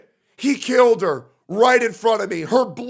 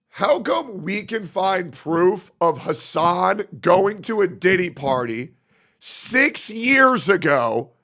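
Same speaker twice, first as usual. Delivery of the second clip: usual speaking voice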